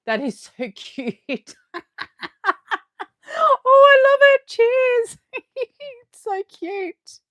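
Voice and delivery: very high, loud giggly squeal; high, loud giggling squeal over a cute pumpkin cup; delighted squeal. Real, not acted. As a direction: coo over something adorable.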